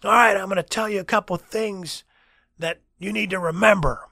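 high, loud lecturing voice; passionate professor. Retold or imitated. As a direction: address the whole class emphatically. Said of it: "He was extremely passionate".

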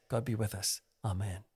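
soft, low "God be with us"; low-volume prayer. Shown as a demonstration. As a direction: let the prayer drop away, quiet and low. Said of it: "he probably meant volume, you know, rather than say".

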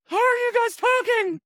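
high, strained cold-shocked voice; freezing friend. Retold or imitated. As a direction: squeak it out like freezing water. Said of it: "I just remember him saying".

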